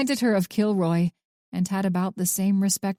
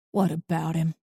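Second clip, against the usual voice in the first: sneer; 3 semitones lower than usual. A narrator's reading. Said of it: "Arthur sneered back at her".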